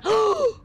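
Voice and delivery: loud, high gasp of amazement; sharp intake of breath at a great find; startled gasp. Real, not acted.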